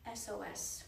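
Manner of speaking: a soft, grave half-whisper. A narrator's reading. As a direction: say it low under your breath. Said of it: "whispered Jack".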